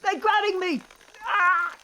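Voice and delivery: high, loud panicked cries; panicked yelling. Acted. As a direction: scream for help, trapped. Vines grabbing them.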